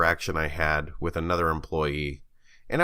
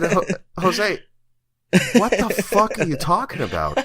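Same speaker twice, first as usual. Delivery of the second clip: baffled retort; 12 semitones higher, 5 dB louder than usual. Retold or imitated. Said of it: "I said".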